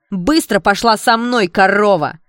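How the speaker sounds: slow, very loud enraged order; high, full-voiced angry shout; furious yell. A narrator's reading. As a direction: scream the order, temper lost. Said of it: "заорала Шленская".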